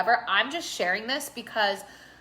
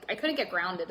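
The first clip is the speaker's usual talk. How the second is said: ordinary talk, nothing marked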